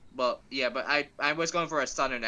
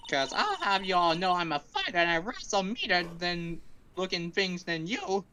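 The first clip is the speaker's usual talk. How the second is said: put-on Southern accent; 6 semitones higher than usual. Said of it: "you know where I'm going with the accent".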